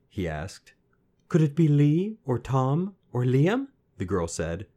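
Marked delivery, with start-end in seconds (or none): 1.3-3.7 high, loud, rising questions; tentative guessing. A narrator's reading. Girl teasing out guesses, questioning. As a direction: offer each name with a lift.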